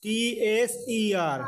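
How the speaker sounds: usual speaking voice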